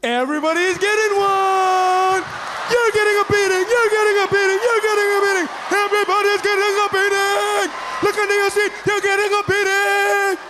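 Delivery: high, sustained sing-out announcement; giveaway host shout. Acted. Host announcing beatings to the whole audience, high exultant shouting. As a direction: belt it out with stretched endings, far above normal pitch.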